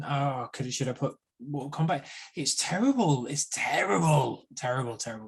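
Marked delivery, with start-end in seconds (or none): none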